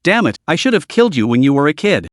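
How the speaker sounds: ordinary delivery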